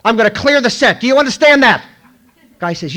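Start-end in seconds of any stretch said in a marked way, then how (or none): 0.0-1.8 loud, high scolding threat; angry warning. Retold or imitated. Director warns the press, loud angry scolding. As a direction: threaten to clear the set.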